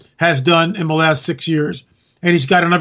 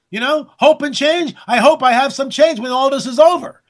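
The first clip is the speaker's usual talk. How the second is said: ordinary talk, nothing marked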